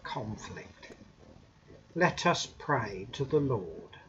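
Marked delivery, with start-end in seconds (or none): none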